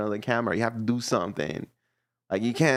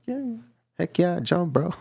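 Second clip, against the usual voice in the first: excited dog voice; 8 semitones higher than usual. Retold or imitated. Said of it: "the dog is like".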